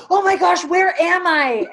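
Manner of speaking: startled high voice, words dragged out; panicked. Retold or imitated. Brother waking on a stranger's couch, panicked. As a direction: jolt awake in a panic.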